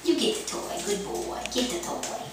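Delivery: soft whisper to the dog; whispered coaxing. Real, not acted. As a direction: coax the dog gently in a whisper. Whispered encouragement to pet.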